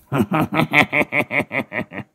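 staccato triumphant cackle; delighted sorcerer's cackle; cackling laugh. A narrator's reading. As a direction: finish with a long gleeful chuckle. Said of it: "cười hình hạch".

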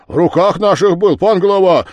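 high, loud excuse; constables' protest. Acted. Constables answer the village head, high and loud. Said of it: "отвечали десятские".

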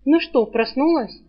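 plain reading voice

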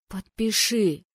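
firm, insistent order; emphatic but controlled insistence; insistent demand. Acted. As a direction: press him to comply. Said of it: "настаивала я на своём".

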